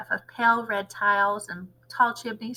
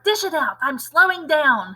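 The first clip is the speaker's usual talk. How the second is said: yelling; 5 semitones higher, 5 dB louder than usual. Acted. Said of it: "she yelled".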